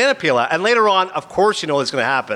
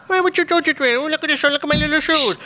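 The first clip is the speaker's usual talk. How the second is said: put-on high, babyish man-child voice; 12 semitones higher than usual. Comic imitating Adam Sandler's baby voice again. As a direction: coo like a little kid showing off shoes. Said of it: "whispers like a baby".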